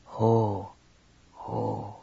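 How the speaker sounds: low husky murmur in her ear; husky intoning. A narrator's reading. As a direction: intone it huskily, close and low. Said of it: "his husky voice intoned in her ear".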